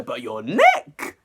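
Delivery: slow, drawn-out "neck?" rising in disbelief; incredulous stress on neck. Real, not acted. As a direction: stretch "neck" out, can't believe it. Stunned by neck stab lyric.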